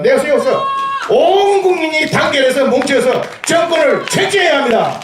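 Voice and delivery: high booming call to unite; rousing shout. Real, not acted. Rally speech, call to unite.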